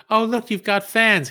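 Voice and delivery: high, loud, slow teasing voice; Ashley teasing. Retold or imitated. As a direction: tease him about his little fans. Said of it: "Ashley, she goes".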